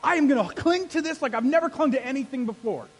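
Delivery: loud, high, impassioned shout; righteous anger. Acted. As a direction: cry out to God in holy fury. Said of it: "you're like".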